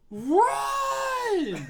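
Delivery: long, high, full-voiced yell. Julie warns Sarah of the killer, drawn-out yell. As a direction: scream the word out, stretched long. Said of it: "Julie just yells".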